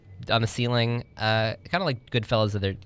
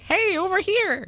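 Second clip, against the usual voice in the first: very high, slow squeaky little voice; tiny creature voice; slower than usual. Retold or imitated. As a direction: call out like a tiny hidden creature. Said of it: "You hear, you hear a little voice".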